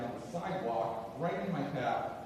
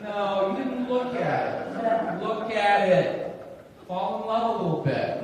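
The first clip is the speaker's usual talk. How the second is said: loud, raised-pitch exasperated complaint; indignant; 7 semitones higher, 8 dB louder, slower than usual. Acted. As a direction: protest with exasperation, drawing it out. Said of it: "it made me mad".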